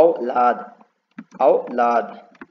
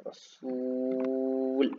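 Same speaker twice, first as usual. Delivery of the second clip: रसूल stretched very slowly and softer, the ू held long; drawn-out word; 7 dB quieter, much slower than usual. Shown as a demonstration. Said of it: "खींच खींच के हमको लिखना हो". (ऊ खींचकर धीमे से रसूल)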